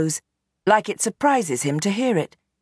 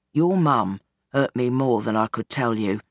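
lower, weary father pleading his case; old gangster Bernie; 6 semitones lower than usual. Acted. Gangster father explains himself to his daughter, low and weary. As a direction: sound like a tired old crime boss.

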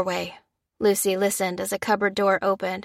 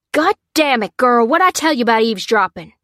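loud, high scolding from dad; angry cry; 4 semitones higher, 6 dB louder than usual. A narrator's reading. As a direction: shout at the daughter caught eavesdropping. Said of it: "he cried".

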